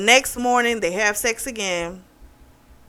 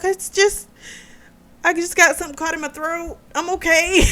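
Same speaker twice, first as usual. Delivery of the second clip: high, quick, fake-casual girl voice; flustered cover-up; 10 semitones higher than usual. Woman at dinner to the restaurant owner, flustered high cover-up. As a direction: squeak out an excuse, trying to sound normal.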